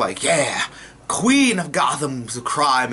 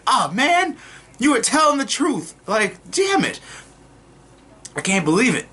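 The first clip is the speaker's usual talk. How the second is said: mock disbelief; 9 semitones higher than usual. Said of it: "I was like".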